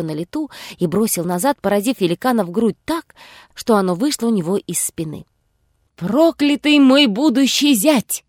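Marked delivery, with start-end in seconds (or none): 6.0-8.2 slow, higher pained cry; giant crying out. A narrator's reading. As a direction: drag out the curse in pain. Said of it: "вскричал Избададен".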